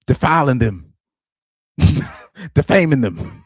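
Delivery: a hint of a Southern lawman, getting worked up; mock Southern accent rising to indignation. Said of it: "I should really say this in a Southern accent".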